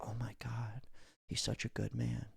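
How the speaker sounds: breathy admiring whisper of a woman; swooning whisper. Retold or imitated. A woman whispering in awe about a caring son. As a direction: whisper it like she's melting over him. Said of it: "they're almost like".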